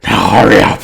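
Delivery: loud, out-of-breath hag's order; heavy-breathing hag. Acted. Winded hag orders her minion on, breathless loud command. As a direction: bark it between heavy breaths. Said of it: "breathing really heavily".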